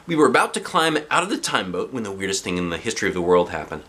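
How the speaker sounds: plain reading voice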